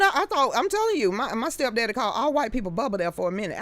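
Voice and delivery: ordinary delivery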